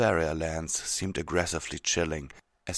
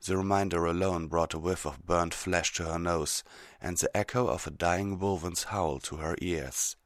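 ordinary delivery, nothing marked

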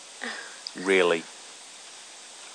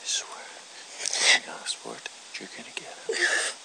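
menacing whisper; 6 dB quieter than usual. Acted. Whispered threat.

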